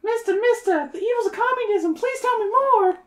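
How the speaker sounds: very high, squeaky put-on plea; hammed-up high-pitched begging; eager pleading listener. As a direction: beg in a mock high voice. Voicing an eager listener, joking.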